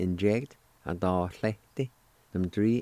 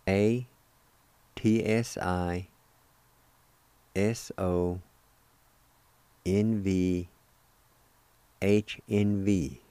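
careful, slow spelling for learners; slow letter-by-letter spelling; much slower than usual. Shown as a demonstration. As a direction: spell each letter slowly, with long gaps. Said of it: "Just spell like this, phonetically".

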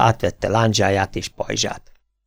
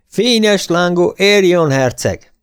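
slow, higher, louder proclamation; herald's cry; 8 semitones higher, 4 dB louder, slower than usual. A narrator's reading. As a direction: call the prince's name out across the field. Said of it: "kiáltotta egy herold".

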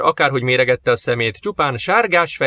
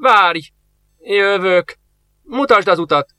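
higher, louder call after someone; old master calling after the soldier, desperate; 7 semitones higher, 4 dB louder, slower than usual. Acted. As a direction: shout after the departing man in desperation. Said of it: "utána kiáltott".

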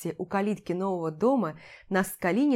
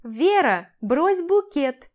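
high, loud call with stretched words; loud demand; 6 semitones higher, 7 dB louder, slower than usual. A narrator's reading. Mother-in-law demanding the bride's bouquet, loud call. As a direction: call it out as an insistent order. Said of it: "громко потребовала моя свекровь".

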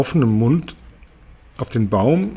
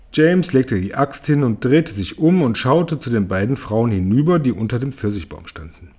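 ordinary delivery, nothing marked

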